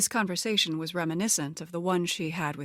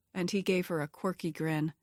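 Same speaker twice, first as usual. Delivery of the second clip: usual speaking voice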